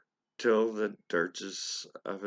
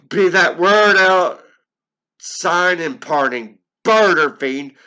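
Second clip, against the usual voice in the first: much higher and louder, a piercing scream; shriek; 9 semitones higher, 16 dB louder than usual. Acted. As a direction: shriek at the fiend to leave. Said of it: "I shrieked".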